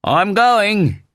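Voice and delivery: very high, loud yell; full-voiced shouted announcement. A narrator's reading. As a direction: call it out over the roar. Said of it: "the voice yelled".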